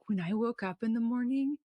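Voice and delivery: ordinary talk, nothing marked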